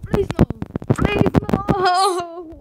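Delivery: high, pleading cry; desperate plea. Real, not acted. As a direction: beg the game in despair. Pleads while failing.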